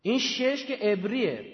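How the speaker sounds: ordinary talk, nothing marked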